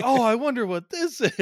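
high-pitched, loud mock curiosity; badly faked puzzlement, pitched right up; feigned wonder. Someone given a bicycle-shaped present, feigning wonder. Acts out receiver of obvious gift.

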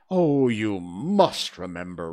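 slow, loud insistence; loud, drawn-out royal warning; menacing king. A narrator's reading. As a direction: lean heavily on the order. King threatens the Hatter.

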